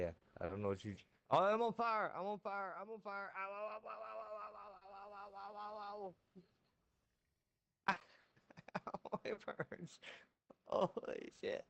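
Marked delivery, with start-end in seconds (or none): 1.3-3.3 high panicked cries, fading; panicked yelling. Real, not acted. Character catches fire in game. 3.3-9.1 high rapid ow yelps, fading; pained yelps. Real, not acted. In-game burning, yelping in pain. 9.1-11.6 pained high cry. Real, not acted. Still burning in game.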